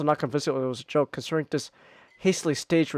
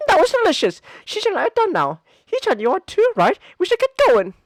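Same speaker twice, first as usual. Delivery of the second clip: much higher, bright chirpy voice; cheerful girl; 13 semitones higher, 7 dB louder than usual. Acted. Misha announces they're leaving, cheerful. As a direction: chirp it sweetly.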